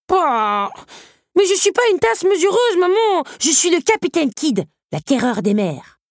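put-on very high-pitched little kid voice; protesting child pirate. A narrator's reading. Little teacup boasting to mother of being a pirate, protesting. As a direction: whine back, then boast as a fearsome captain.

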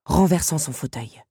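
usual speaking voice

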